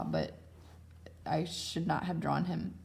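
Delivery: usual speaking voice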